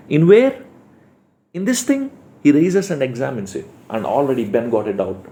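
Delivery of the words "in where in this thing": high, puzzled questioning voice; a lightly voiced puzzled man; puzzled Ben. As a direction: ask it like a baffled husband, pitched up. Teacher voices Ben's puzzled question.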